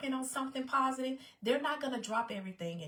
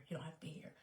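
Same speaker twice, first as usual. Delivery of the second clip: much softer, voiceless whisper; whispered repeat; 4 semitones lower, 13 dB quieter, much faster than usual. Real, not acted. Telling viewers they can leave, whispered.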